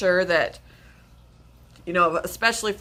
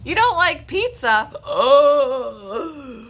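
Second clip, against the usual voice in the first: shocked; 7 semitones higher, 5 dB louder, much slower than usual. Pretend. Mock dismay at pizza.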